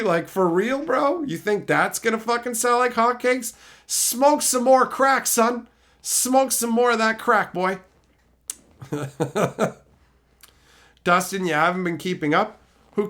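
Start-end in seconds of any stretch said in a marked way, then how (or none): 3.9-7.8 mock scornful drawl. Mocking the marketers, drawn out.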